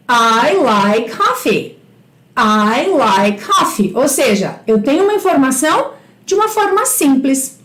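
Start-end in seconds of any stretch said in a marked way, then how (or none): 0.1-3.9 very slow, carefully spaced words; slow teacher demo. Shown as a demonstration. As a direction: model it slowly for beginners. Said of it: "E agora eu digo". (professora modela a frase bem devagar)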